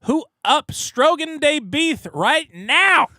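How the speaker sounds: reading a donation in a goofy voice; goofy hype call. Acted. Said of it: "reading joke donation".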